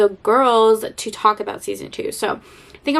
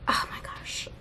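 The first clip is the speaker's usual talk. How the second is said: whisper; 12 dB quieter than usual. Real, not acted. Gushing about favourite couple.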